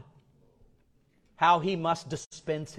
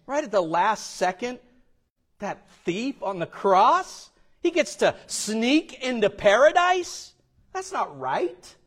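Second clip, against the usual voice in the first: mock indignation; 9 semitones higher, 8 dB louder than usual. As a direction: act out the offended believer, higher and louder. Continues mimicking indignant churchgoer.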